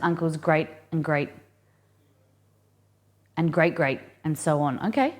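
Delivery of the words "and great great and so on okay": ordinary talk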